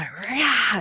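short loud roar, pitch up; lion roar. Shown as a demonstration. Releasing outrage with a loud lion roar. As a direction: let the outrage out in one roar. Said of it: "I can just go".

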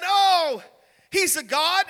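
mocking. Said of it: "and he mocked them".

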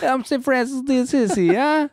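very high, hammed-up Italian accent; hacky Italian accent. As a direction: imitate a kid's bad stage Italian. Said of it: "on this Italian accent that he's doing".

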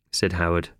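ordinary delivery

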